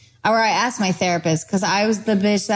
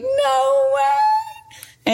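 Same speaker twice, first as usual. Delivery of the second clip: very high squealed disbelief; excited squeal. Retold or imitated. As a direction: shriek it with joy. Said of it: "screaming and being like".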